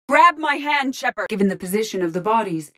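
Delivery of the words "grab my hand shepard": high-pitched call to a comrade; urgent call. Acted. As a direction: call out to rescue the commander. Calling to commander in danger.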